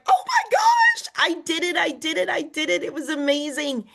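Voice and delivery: squealing high, giddy oh my gosh; imagined joy. Pretend. You next year celebrating the goal, giddy and high. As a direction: burst out in giddy disbelief. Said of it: "and you're like".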